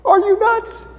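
very high squeaky disbelief; incredulous falsetto. Acted. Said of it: "Elisha's like".

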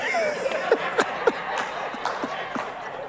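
sudden high airy burst of laughter; cracking up mid-sermon; high breathless laugh. Real, not acted. Cracking up at own story.

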